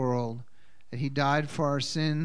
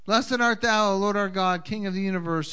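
louder, raised solemn prayer voice; formal blessing; 5 semitones higher, 6 dB louder than usual. Acted.

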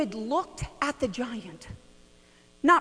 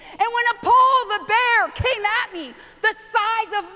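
bold David; 7 semitones higher than usual. Retold or imitated. David to the army, boldly testifying. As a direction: proclaim like the shepherd boy. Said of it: "David was saying".

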